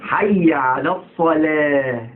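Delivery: slow chanted الصلاة with a thin "leh" ending; light lam. Shown as a demonstration. Teacher modelling the call to prayer, chanted light lam. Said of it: "اللام هنا رقيقة".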